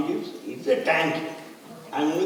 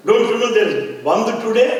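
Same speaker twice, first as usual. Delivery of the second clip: louder, higher, demanding questions; mock challenging shout; 7 semitones higher, 6 dB louder than usual. Communist leader stopping the car, demanding loudly.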